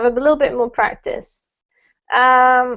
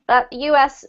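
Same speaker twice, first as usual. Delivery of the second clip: ordinary talk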